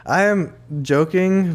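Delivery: normal talking voice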